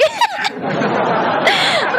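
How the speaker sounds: shrill cackle of a laugh up high; high mocking giggle; high shrieking laugh. Real, not acted. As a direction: burst out in a squealing laugh. Laughing at rival's anger.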